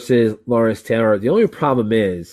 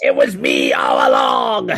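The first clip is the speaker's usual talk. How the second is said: much lower, slow shouted reveal; slow booming mock McMahon gloat; Vince McMahon; 12 semitones lower, slower than usual. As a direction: drag it out like a gloating villain boss. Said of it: "when you find out Vince".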